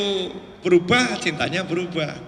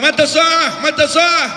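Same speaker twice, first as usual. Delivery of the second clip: high, raised-voice interruption; imitated villager's shout; 7 semitones higher, 4 dB louder, slower than usual. Villager interrupts the Prophet's sermon, imitated shouting. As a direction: call out over someone else's sermon. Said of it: "Dia teriak-teriak".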